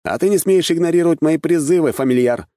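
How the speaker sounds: high-pitched outraged retort; indignant, voice pitched right up. A narrator's reading. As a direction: throw the accusation back in protest. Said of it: "возмутился я".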